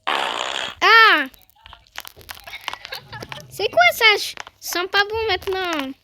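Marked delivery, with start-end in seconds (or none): 0.1-1.2 startled scream. Real, not acted. Sudden fright in game. 3.5-4.0 surprised. Real, not acted. Reacts to strange item.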